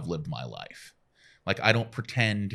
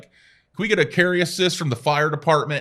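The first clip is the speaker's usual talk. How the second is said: louder paramedic call on the radio; imitating paramedic; 7 semitones higher, 6 dB louder than usual. Paramedics asking for the fire department, lightly voiced call. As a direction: call it in like the crew did. Quoting the ambulance crew.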